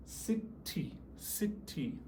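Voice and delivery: "City" softly split into sit, then tee; slow syllable-by-syllable repeat. Shown as a demonstration. Teacher repeating a word on request, slow split syllables. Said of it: "Say it again".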